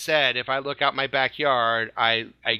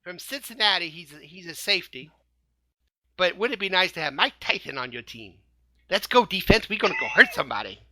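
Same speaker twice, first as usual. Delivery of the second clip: lisping boxer impression; hammed-up lispy fighter voice; Mike Tyson; 3 semitones higher than usual. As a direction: talk like the boxer, with his lisp. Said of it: "I don't ever do my Mike Tyson".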